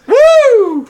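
loud high whoop; excited whoop. Real, not acted. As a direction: cheer it out wildly. Cheering for a drink round.

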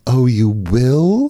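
slow, knowing mock of the mother; sly mother. Mother tricking her child, slow sly voice. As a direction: drag it out like she's won.

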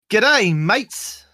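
high, loud hammed-up Australian accent; over-the-top Australian accent from a British speaker; fake Australian accent. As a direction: ham up a bad Australian accent for laughs. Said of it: "British trying to do Australian".

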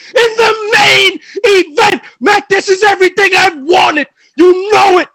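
yelling at his rival Mac, voice cracking with a cry; screaming promo. Acted. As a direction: scream your challenge at the king.

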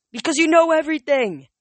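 high, loud shout while wrestling. Acted. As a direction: yell it back. Hero shouts back.

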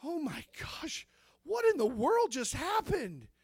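slow, awed disbelief; hushed then rising amazement; stunned. Retold or imitated. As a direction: marvel at what just happened, slowly. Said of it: "I thought".

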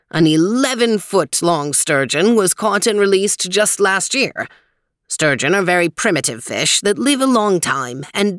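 lightly voiced old man, measured and helpful; a hint of a friendly old guide, unhurried; old man Weezer. Weezer speaks from screen.

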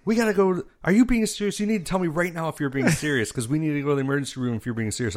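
high, urgent mock panic; high-pitched rush, speeding up to the end; freaking out. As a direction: imitate someone frantically demanding a straight answer. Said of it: "she starts freaking out".

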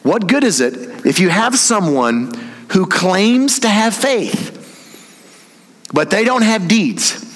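slow, high, drawn-out challenge; confrontational. Acted. Preacher confronting those who claim faith without deeds, confrontational. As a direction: stretch it out and press the point with long pauses. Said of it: "he's very confrontational".

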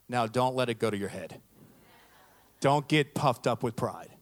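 imitating a suddenly stern grandmother; low, restrained warning with a stern edge. Grandmother cautioning grandson after praising him, stern. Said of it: "just changes and just gets stern".

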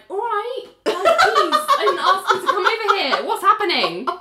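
high-pitched, laughing discomfort; mock bewilderment. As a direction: react to an oversharing stranger, voice way up. Imagined bewildered reply to oversharing stranger.

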